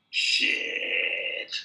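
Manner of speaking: put-on low, rough drunken mutter; drunk's low mutter. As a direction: sneer it like a drunk sizing him up. Drunk eyes his long hair.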